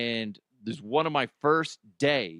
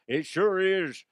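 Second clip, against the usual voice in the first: wrong Connery voice; 6 semitones higher, slower than usual. Pretend. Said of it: "that's not the right voice".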